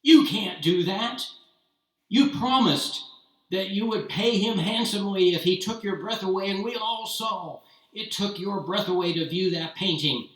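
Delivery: loud, high, furious protest; angry outburst. Acted. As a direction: shout him down in outrage. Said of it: "this outburst".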